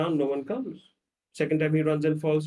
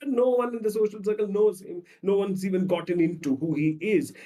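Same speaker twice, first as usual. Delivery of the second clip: ordinary talk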